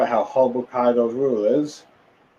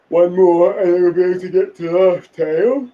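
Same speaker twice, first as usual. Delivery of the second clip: higher, louder character voice; Robin's line; 6 semitones higher, 5 dB louder than usual. Retold or imitated. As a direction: voice the heroine's eager remark. Voicing Robin's comment on poneglyph.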